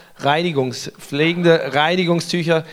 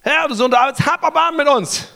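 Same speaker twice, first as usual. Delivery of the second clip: high crying-out plea; calling out loud; 9 semitones higher than usual. Acted. Blind men beg Jesus from the roadside, calling out. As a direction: call out for mercy, loud and high. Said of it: "riefen sie laut".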